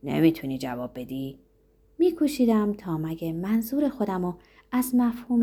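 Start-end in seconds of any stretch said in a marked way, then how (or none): 0.0-1.4 low-pitched, mildly upset; uneasy question. A narrator's reading. Zorba awaits his boss's answer, mildly upset. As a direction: ask with understated distress. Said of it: "زوربا با ناراحتی پرسید".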